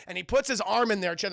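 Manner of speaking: usual speaking voice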